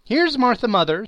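high, loud cheerful call; bright announcement. A narrator's reading. Daughter announcing herself to her mother, high excited call. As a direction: announce her arrival with delight. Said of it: "said a girl, appearing as she spoke".

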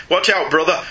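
normal talking voice

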